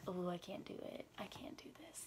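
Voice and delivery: soft whispered refusal; frightened whisper. Retold or imitated. Girl at the bedroom door, whispering in fear. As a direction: whisper that you can't do it.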